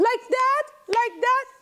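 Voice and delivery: high, bewildered question; unsure question. Acted. Great-grandma unsure of snapping, high and bewildered. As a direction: ask it anxiously.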